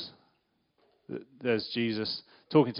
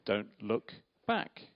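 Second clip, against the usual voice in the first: softer, slow and calm; Jesus gently telling Peter, softer and slower; gentle; 5 semitones lower, 7 dB quieter, slower than usual. Shown as a demonstration. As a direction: say it kindly and slowly, no alarm. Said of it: "It's just Jesus saying to Peter".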